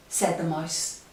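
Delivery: ordinary delivery, nothing marked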